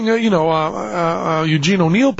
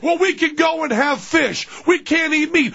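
much higher, strained parent voice; exasperated parent; 12 semitones higher than usual. Acted. Parent offers fish to the kid, exasperated. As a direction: plead with a screaming child, voice pitched up.

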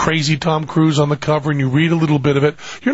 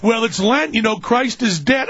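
higher-pitched parent explaining; exasperated parent; 7 semitones higher than usual. Acted. Parent tells the kids about Lent, strained explaining. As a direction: reason with screaming kids, voice pitched up.

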